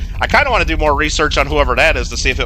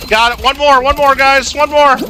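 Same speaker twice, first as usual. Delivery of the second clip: high, louder burst of excitement; excited urging; 10 semitones higher, 4 dB louder than usual. Real, not acted. Streamer urging the guys on for one more, excited. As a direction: urge them on, pitch jumping way up. Rallying players as game nears win.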